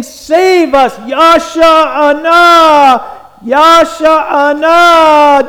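loud, high, drawn-out shout to God; slow, ringing shouted plea; emphatic cry. Shown as a demonstration. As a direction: cry it out, stretching the last vowel long. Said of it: "this emphatic thing".

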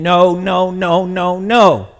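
repeated, insistent refusal; emphatic John. Retold or imitated. John refusing Jesus over and over, emphatic. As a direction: keep saying no, landing hard on the last. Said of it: "this was an emphatic conversation".

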